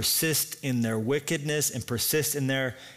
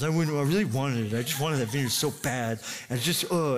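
mock pouting, self-pitying moan; sulking. Pampered king moans about the vineyard he wanted, sulking. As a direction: play a pampered king in a sulk. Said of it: "He was sulking".

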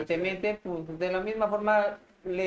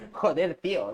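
put-on Spanish Spain accent; 3 dB louder than usual. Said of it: "te dicen".

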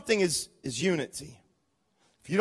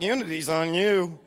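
a lower, smug jab at the neighbour; mock tempted reply; 4 semitones lower than usual. Imitating a churchgoer who blames the neighbour. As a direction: pass the blame with a grin. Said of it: "You're like".

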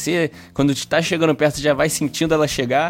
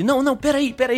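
a higher, hurried plea past security; pleading movie hero; 11 semitones higher, faster than usual. Retold or imitated. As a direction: mildly act out the hero brushing guards aside. (imitação leve do herói implorando)

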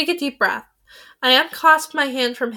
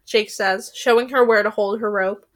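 ordinary delivery

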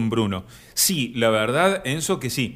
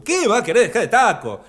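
mock scolding; 6 semitones higher, 3 dB louder, faster than usual.

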